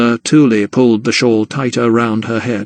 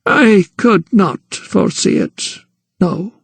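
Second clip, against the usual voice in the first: slow, higher and guarded; reticent old woman; 8 semitones higher, slower than usual. Acted. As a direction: hold back, a gran hiding something. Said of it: "her response oddly reticent".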